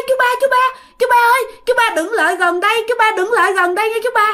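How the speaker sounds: very high, frantic pleading call; mock panic, voice pitched right up; acted alarmed calling. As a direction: cry out to keep someone from coming closer. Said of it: "bắt đầu kêu".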